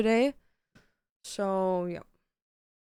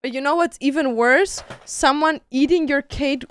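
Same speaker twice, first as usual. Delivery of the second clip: loud, indignant rant; 2 semitones higher, 10 dB louder than usual. Real, not acted. Telling viewers about her eaten cake, loud and indignant. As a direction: complain at full volume.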